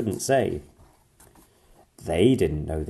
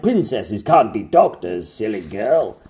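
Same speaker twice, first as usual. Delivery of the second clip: louder scoffing king voice; scornful king; 2 semitones higher, 8 dB louder than usual. A narrator's reading. King dismissing Pearl, scornful. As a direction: dismiss her like a stern king. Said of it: "The king replied".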